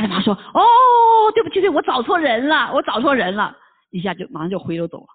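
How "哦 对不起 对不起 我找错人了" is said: much higher, louder, whimpering apology; panicked apology. Acted. Satan begs Jesus's pardon in a much higher whimpering panic. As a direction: squeal it out like a frightened cowering devil.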